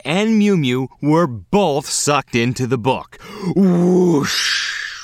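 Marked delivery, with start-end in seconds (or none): 3.6-5.0 whoosh sound. Acted. Sucked into the book.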